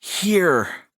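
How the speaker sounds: high, drawn-out, anxious single word; trembling. A narrator's reading. Trembling brother answers his brother, high and anxious. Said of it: "Shep trembled".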